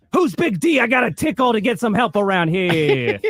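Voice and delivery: high, hammed-up East Coast holler; East Coast accent yell. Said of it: "in an indistinguishable East Coast accent".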